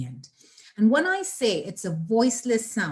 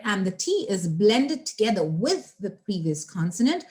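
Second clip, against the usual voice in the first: ordinary talk, nothing marked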